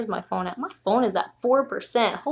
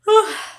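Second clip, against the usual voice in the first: loud sigh; 13 semitones higher, 10 dB louder than usual. Real, not acted. Tired after stairs.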